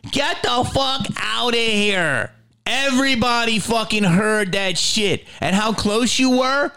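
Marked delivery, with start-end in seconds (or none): 0.1-2.3 flatter repeat. Acted. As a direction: say it again, heavier and falling. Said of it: "I didn't know nothing".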